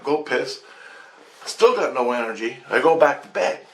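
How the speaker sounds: usual speaking voice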